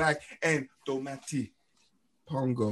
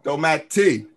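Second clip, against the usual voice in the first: Domati louder, leaning on the final "ti"; copied final stress; 6 dB louder, slower than usual. Retold or imitated. Host trying the correction, exaggerating the end of Domati. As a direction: echo Domati with a big TI ending.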